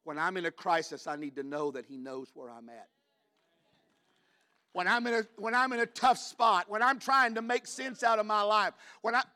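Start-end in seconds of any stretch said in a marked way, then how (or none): 4.7-9.2 raised, high-pitched preacher's voice; urgent pulpit build; impassioned preaching. Real, not acted. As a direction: preach it louder and higher, driving each phrase.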